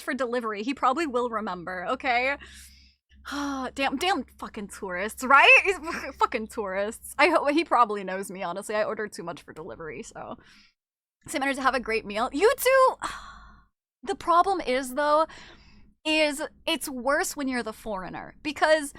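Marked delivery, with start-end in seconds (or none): none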